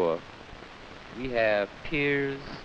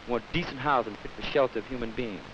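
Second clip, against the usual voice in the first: ordinary delivery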